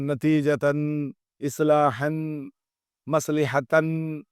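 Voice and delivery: endings نتیجتاً اصلاحاً مصلحتاً said slowly with clear -an; slow classroom reading of tanween words; nunation shown. Shown as a demonstration. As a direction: stress the final n of each word slowly. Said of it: "اس طرح کی آواز آتی ہے".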